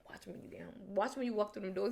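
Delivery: whispered, breathy warning; warning boyfriend in a whisper before they get home; hushed threat. Retold or imitated. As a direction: hiss the threat under your breath. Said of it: "I said".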